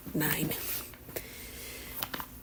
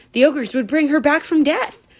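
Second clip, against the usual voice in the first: usual speaking voice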